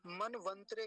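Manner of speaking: "मनवंतरे" with त squeezed into "त्रे"; mistaken pronunciation. Shown as a demonstration. Teacher quoting people's common error, half त. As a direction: run "मनवंतरे" together, dropping the vowel after त. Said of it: "जो गलत करते हैं".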